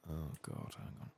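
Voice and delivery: ordinary delivery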